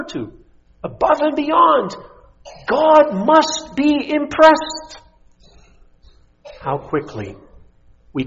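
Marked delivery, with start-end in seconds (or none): 0.8-5.0 sarcastic. Acted. Mocking the Pharisee's boast.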